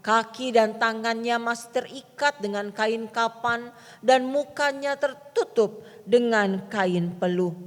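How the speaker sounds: measured Bible reading to the congregation; solemn scripture reading. Acted. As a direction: read the verse steadily, like a lectionary. Continues scripture reading, closing formula.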